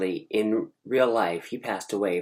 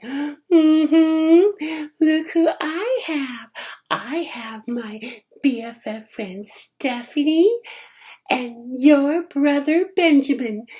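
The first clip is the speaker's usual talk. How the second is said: much higher, louder taunting voice, slow; sassy attitude; 8 dB louder, slower than usual. Retold or imitated. Cousin Melanie taunting him, sassy high voice. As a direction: gloat like you've won. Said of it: "this attitude like".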